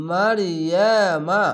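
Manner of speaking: মারিয়ামা very slow, with a long drawn alif. Shown as a demonstration. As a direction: stretch the vowel in মারিয়ামা for one alif length. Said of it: "আলিফ টেনে পড়বেন".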